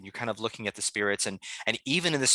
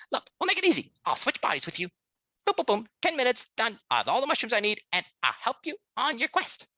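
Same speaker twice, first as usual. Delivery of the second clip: high-pitched, energetic mouse sales pitch; manic little mouse; 13 semitones higher, 3 dB louder than usual. Acted. Mouse offers John a body swap, manic. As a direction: sell the deal in a squeaky, excitable voice. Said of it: "the mouse kinda turns up to".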